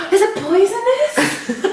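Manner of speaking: high, slow, giggling fright; laughing mock fear. Spider-phobic wife asking her husband, laughing mock fear. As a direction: ask it scared while laughing.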